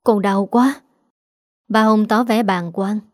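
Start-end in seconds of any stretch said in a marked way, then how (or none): 0.0-0.8 understated groan of pain; faint moan of pain.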